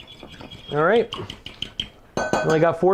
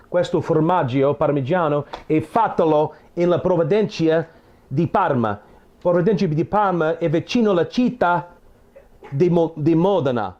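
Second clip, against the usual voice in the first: practicing his Italian on the viewers, Italian accent; easy Italian phrases in an Italian accent; speaking Italian. Shown as a demonstration. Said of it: "I practice on you a little bit".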